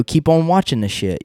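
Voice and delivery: normal talking voice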